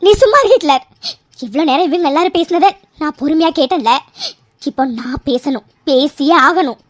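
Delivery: low, firm, insistent voice; firm anger. Acted. Mithra tells Hitler to hush, low firm anger. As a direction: hold them back, low and stern.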